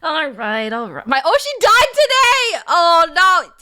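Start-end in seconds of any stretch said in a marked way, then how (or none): 1.1-3.5 loud, high mock lament; dramatic wail. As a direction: wail it like a tragedy. Hammed-up grief over avatar.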